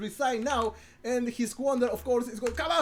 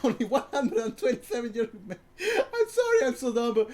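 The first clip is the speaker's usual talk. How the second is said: laughing through his own mistake; laughing at himself; 2 semitones higher, 4 dB louder than usual. Real, not acted. Laughs at own misread number.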